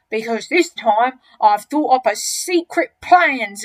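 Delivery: slow, loud, relishing the scheme; enormous crocodile, gloating. Acted. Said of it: "The enormous crocodile grinned again".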